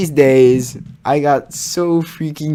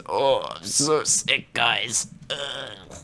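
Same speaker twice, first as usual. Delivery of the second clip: soft, slow fake-sick moan; faking sick; 8 dB quieter, much slower than usual.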